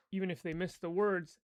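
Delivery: normal talking voice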